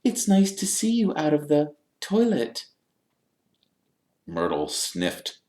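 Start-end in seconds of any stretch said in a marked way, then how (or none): 0.0-2.7 high, awkward forced pleasantry; falsely bright Hermione. A narrator's reading. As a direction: make polite small talk with fake cheer. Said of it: "in a falsely bright voice".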